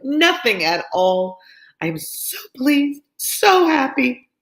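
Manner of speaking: high, slow, giddy squeals of delight; gushing Mrs Bennet. Acted. Mrs Bennet gushing to Lizzie over her engagement. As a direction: swoon over her daughter's riches. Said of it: "such an effusion".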